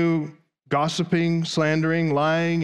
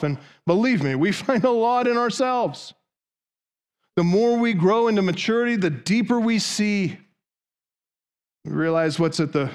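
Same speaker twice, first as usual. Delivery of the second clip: ordinary talk, nothing marked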